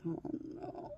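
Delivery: soft, breathy whispered "oh no"; dismayed hush. Retold or imitated. Reacting to the stepdaughter's news, hushed whisper. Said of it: "and I was like".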